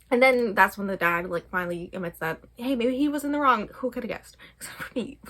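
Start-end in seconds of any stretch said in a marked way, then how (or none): 3.8-5.2 dry whispered aside, breath only; whispered sarcasm. Real, not acted. Vlogger mocking a predictable plot twist, whispered sarcasm.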